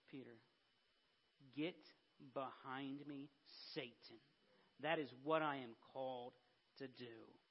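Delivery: low, soft rebuke with weighty pauses; Jesus, grave rebuke. Retold or imitated. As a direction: answer quietly and firmly, spacing the words. Jesus' grave reply to Peter's scolding.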